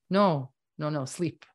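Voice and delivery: ordinary talk, nothing marked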